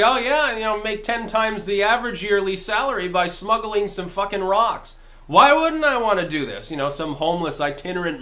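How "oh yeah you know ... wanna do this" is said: higher-pitched, mock-eager smuggler; higher, lively quote with a loud indignant question at the end; eager imitation. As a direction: play someone jumping at easy money, pitched up. Voices eager people smuggling within China.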